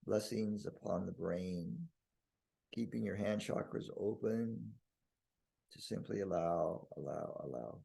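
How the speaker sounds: calm, spacious voice that settles at phrase ends; guided meditation blessing. Real, not acted. Leading listeners in blessing the brain, hushed meditation. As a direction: bestow a peaceful blessing, unhurried.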